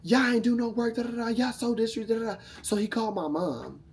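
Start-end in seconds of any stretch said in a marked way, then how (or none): 0.0-2.4 angry man. Retold or imitated. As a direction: scold them like a furious boss. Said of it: "And he was like".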